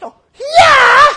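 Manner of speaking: very high, loud drawn-out squeal; mock child shriek; tiny girl. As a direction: squeal it as an even smaller girl. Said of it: "En het meisje zegt".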